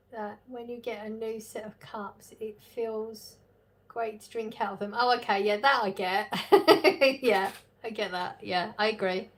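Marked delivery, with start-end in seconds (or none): 6.4-7.2 loud short burst of laughter. Real, not acted. Laughs after agreeing.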